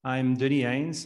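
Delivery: usual speaking voice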